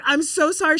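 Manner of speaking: high, upset re-enacted apology; tearful apology, retold. Mother to hurt daughter, retold tearful remorse. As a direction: apologise as if welling up. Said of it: "Like, I'm tearing up".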